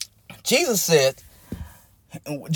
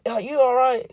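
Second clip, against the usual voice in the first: lamentable call; 7 semitones higher than usual. Acted. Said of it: "but it's in a lamentable voice".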